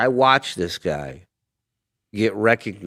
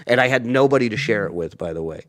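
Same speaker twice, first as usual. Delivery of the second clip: ordinary talk, nothing marked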